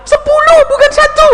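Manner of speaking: a donor correcting the announcement, indignant; shocked protest. Retold or imitated. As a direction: burst out in outrage at the wrong figure. Donor hears the wrong sum.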